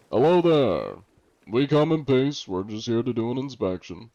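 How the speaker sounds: opens loud and high, then eases off; John Anti-Cheat. Pretend. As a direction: hail another crew with a slightly put-on formality. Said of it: "John Anti-Cheat's a little bit of a voice".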